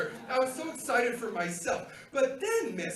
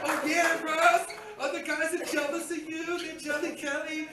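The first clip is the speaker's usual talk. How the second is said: higher-pitched, gleeful self-hype; excited self-congratulation; 5 semitones higher than usual. Retold or imitated. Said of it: "I was so excited".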